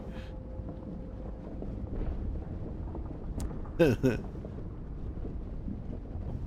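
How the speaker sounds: long breathy silent laugh; helpless wheezing laughter. Real, not acted. Laughing at the inadvisably big dog.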